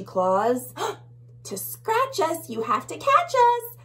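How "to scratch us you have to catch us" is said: high, quick taunt; tiny bug. Acted. Tiny bug taunting the bully, high and fast. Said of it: "says one of the tiny bugs".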